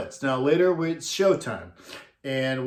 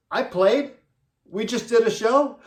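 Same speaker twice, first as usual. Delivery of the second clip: high-pitched, incredulous questions; baffled disbelief; 8 semitones higher, 4 dB louder than usual. Retold or imitated. Ace to his manager after the show, baffled. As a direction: imitate someone amazed by news about himself.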